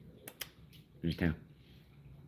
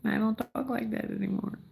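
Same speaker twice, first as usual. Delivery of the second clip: mock old voice. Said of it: "my voice changed".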